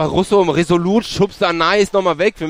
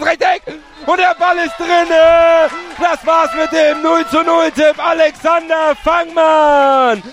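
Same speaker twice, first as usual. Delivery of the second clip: screaming goal commentary; 6 semitones higher, slower than usual. Real, not acted. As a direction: roar out the goal, drawing words out. Goal scored, commentator erupts. (schreiender Torjubel-Kommentar)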